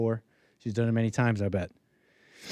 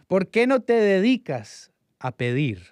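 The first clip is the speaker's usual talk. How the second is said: Spanish quote; 6 semitones higher, 3 dB louder than usual. Retold or imitated. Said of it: "is how he told me".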